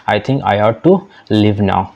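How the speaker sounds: slow, careful classroom English; deliberate teacher read-aloud; slow model reading. Shown as a demonstration. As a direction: spell each word out slowly for learners. Teacher reads model sentence.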